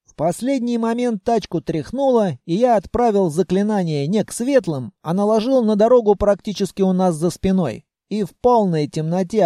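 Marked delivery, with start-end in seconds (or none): none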